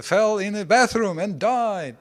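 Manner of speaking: high, loud, hammed-up announcement; mock alarm. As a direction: proclaim a silly tragedy as huge news. Mock dramatic report of absurd death.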